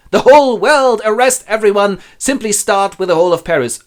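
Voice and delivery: high, loud hysterical orders; frantic demand. Acted. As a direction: bellow sweeping orders in a panic. Robbed miser demands arrests.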